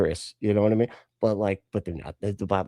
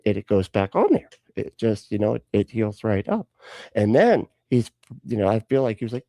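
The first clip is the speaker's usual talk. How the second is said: usual speaking voice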